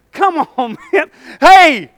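normal talking voice

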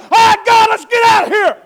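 loud, high panicked shout; startled disciples. Acted. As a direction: yell it while scrambling back into the boat. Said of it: "and said".

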